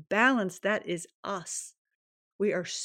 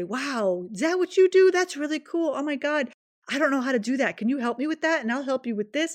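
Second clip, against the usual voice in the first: excited gushing; 7 semitones higher, 6 dB louder than usual. Retold or imitated. Acted admiring voice, high excited.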